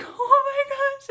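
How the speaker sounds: very high, loud, drawn-out squeal; overwhelmed amazement. Retold or imitated. Mother retelling the second heartbeat, overwhelmed amazement. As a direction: re-enact a big squealed reaction.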